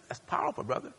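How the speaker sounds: hushed aside. Retold or imitated. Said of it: "I said".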